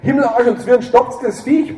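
high, loud furious bellow. Acted. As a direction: roar at them to stop the dog. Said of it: "brüllte Wambrechtshammer".

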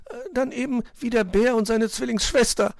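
high piping concession; hesitate, then chirp an alternative; high bird voice giving in; compromising little bird. A narrator's reading. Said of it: "Zwitschi kompromissbereit".

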